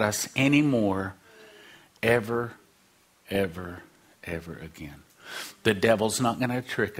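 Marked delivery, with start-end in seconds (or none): 2.0-5.0 each "ever" softer until it is almost gone; fading ever quieter. Acted. Preacher fading the words out to the congregation. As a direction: let "ever, ever, ever again" die away.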